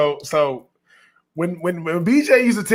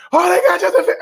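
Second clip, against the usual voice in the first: high, excited retold outburst; high mock panic with laughter; mock freak-out; 11 semitones higher, 4 dB louder than usual. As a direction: imitate a draft fan losing it, high-pitched. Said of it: "just freaking out".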